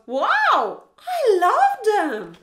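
wolf loudly in love with berries; Wolf delighted. Acted. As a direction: rave about the berries as Wolf. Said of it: "And Wolf goes".

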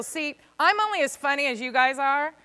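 normal talking voice

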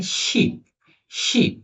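শিপ repeated with a clear sh; pronunciation demo. Shown as a demonstration. Teacher letting pupils hear the sound, pronunciation demo of শিপ. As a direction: model শিপ word by word, sh at the start. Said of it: "এই সাউন্ডটা কি হইতেছে?".

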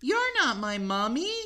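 put-on high little-child voice; high baby mummy. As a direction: say it like a small kid.

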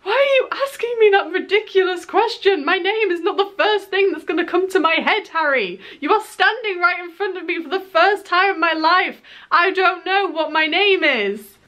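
high, half-joking disbelief, retold; mock exasperated rant. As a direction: plead with the star, amused and flustered. Retold inner rant at star.

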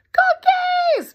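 high, loud excited shriek; child's scream. A narrator's reading. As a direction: scream it like a greedy toddler. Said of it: "Penny screams".

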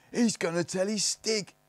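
high, loud, drawn-out jeer; cackling. A narrator's reading. Snargets mock Barney's threat, cackling jeer. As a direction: cackle it mockingly at the top of the voice. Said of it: "cackled the Snargets".

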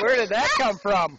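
high, loud surprised shout; surprised yelp. Real, not acted. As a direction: yelp it out in shock. Surprise after the bump.

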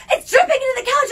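high, loud husband yelling; mock yell of a husband; retold husband's shout. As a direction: shout the alarm at her. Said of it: "My husband's like".

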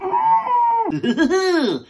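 high manic shouted laugh breaking out. Pretend. Dad cracking up at home during lockdown, manic laugh. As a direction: cackle like a dad losing it.

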